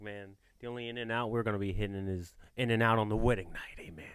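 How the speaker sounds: whispered joke; whispered aside. Retold or imitated. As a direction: breathe it out as a private joke. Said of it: "I'm like".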